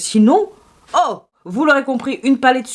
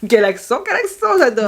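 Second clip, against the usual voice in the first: amused laugh. Real, not acted.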